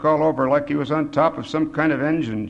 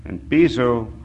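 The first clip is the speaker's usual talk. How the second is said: plain reading voice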